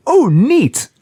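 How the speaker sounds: high, bright little marvel; curious delight. Retold or imitated. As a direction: perk up with childlike interest. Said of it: "this being who's like".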